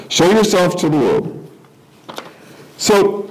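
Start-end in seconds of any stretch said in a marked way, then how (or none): 0.1-1.5 louder, sneering taunt on yourself; mocking. Shown as a demonstration. Brothers daring Jesus, louder sarcastic mockery. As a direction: spit it out as a sarcastic dare. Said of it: "it's ridicule".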